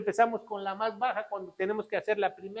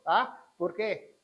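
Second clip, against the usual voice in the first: usual speaking voice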